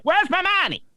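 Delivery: very high, squawked imitation of a lady; freaking-out lady. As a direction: mimic her demand in a high put-on voice. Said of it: "the lady was like".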